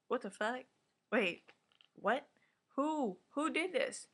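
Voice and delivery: high-pitched, halting disbelief; short stunned questions with pauses between; acted shock. Recapper as German hearing the news mid-workout, mock shock.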